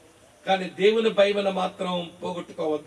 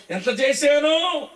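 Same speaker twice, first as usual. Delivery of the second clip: higher, louder, slow drawn-out brag of ఎంత చేశానో; mock boasting; 6 semitones higher, 3 dB louder, much slower than usual. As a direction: stretch out ఎంత చేశానో like a show-off mocking his own good deeds. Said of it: "చెప్పుకునే కబుర్లు కబురు బండం అంత". (ఎగతాళిగా, గొప్పలు చెప్పుకునే రాగంతో, నెమ్మదిగా)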